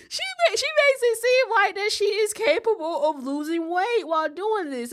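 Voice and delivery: very high, loud mocking voice; ridiculing her weight-loss claim. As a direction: squeal it up in ridicule.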